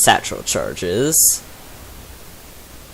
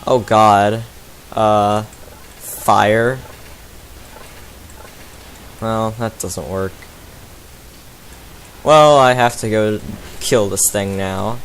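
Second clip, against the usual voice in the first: usual speaking voice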